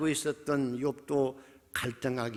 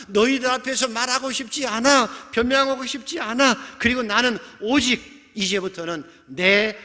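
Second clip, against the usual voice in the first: high, forceful protest to friends; despairing retort; 8 semitones higher, 6 dB louder than usual. Acted. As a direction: declare it hard, done arguing with them. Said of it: "절망 속에서 이렇게 답변합니다". (절박하게 목소리를 높여 항변하듯)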